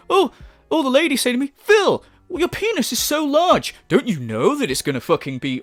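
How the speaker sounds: high, hammed-up mock flattery; mocking imitation. Commentator spoofs him quoting admiring ladies, mocking. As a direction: parody his boastful quotes in a lifted voice. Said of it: "no one talks like that".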